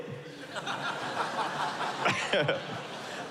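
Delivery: quiet airy laugh at his own joke; long soft laugh. Real, not acted. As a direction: laugh softly at the punchline.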